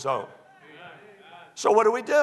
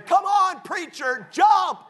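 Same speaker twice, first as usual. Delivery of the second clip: put-on kids cheering; 11 semitones higher, 3 dB louder, slower than usual. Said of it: "those kids are in there having a good time".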